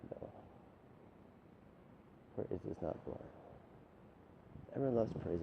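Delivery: ordinary delivery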